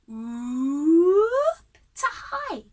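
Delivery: a siren-like glide upward; rising voice whoop. Shown as a demonstration. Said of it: "use just my voice, going from low".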